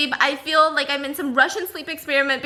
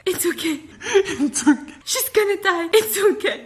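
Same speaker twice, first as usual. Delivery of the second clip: mock panicked reassurance; 4 semitones higher than usual. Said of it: "His eyes were just like".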